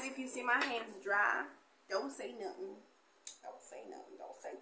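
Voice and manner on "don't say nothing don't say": whispered, much softer. Real, not acted. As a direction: whisper it like a secret aside. Aside about dry hands.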